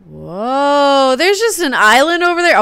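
loud, high-pitched whoa of surprise; real wide-eyed amazement; amazed discovery. Real, not acted. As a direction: blurt it out in astonishment, loud and high. Spots an island unexpectedly.

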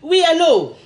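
breezy hello into the phone; bright phone greeting. Shown as a demonstration. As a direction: answer the phone upbeat and casual. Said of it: "quand tu prends l'appel".

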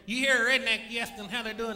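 normal talking voice

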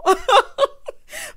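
high, loud burst of laughter; loud laughing. Real, not acted. Laughing at her own story of housewives pillow talk, loud cackling. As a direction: crack up hard, loud and high.

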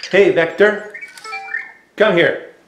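calling out. Real, not acted. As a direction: call it out clearly so the robot hears. Calling robot to the edge.

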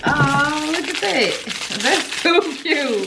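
high, loud, drawn-out gush; delighted gushing. Real, not acted. Reacting to the last gift, delighted gushing. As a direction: gush slowly over something adorable.

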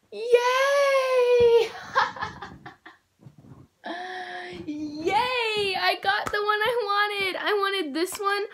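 real joyful squeal with laughter; excited cheering. Real, not acted. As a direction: celebrate getting the one she wanted. Gets the toy she wanted.

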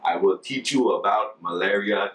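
slow, careful English in a put-on Ghanaian accent; slow Ghanaian-style accent. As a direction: address villagers slowly, imitating their accent. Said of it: "trying to slowly speak English".

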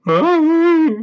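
high, loud startled scream; Shaggy sees a scary shadow outside the tent; terrified cry. Acted. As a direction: shriek at the shadow. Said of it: "cried Shaggy".

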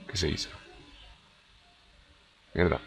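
quiet puzzled mutter; muttering under breath. Real, not acted. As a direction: say it to yourself under your breath. Confused muttering during gameplay.

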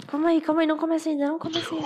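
chamando mais alto e agudo; peça para esperar, alto. Real, not acted. (calma aí, mais alto)

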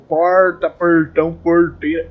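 imitated Paraná accent with heavy r in "porta, portão, porteira". Vlogger showing how Paraná people talk, imitated Paraná accent. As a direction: lean slowly on each r like Paraná locals. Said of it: "não falo que nem o povo fala aí".

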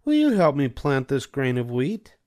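a hen politely asking a neighbour; Little Red Hen. A narrator's reading. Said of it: "She asked the goose".

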